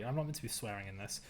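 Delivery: ordinary talk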